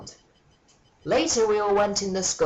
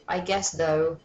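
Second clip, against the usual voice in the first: normal talking voice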